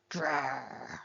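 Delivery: low, quiet little growl; a playful pretend growl; soft mock growl. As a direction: growl softly like a small animal. Growling like an owl.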